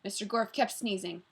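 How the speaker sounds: ordinary delivery, nothing marked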